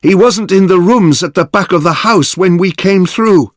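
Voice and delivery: high, loud explanation called across rooms; calling out. A narrator's reading. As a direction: keep calling it out. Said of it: "called Yoshida".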